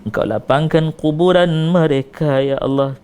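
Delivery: usual speaking voice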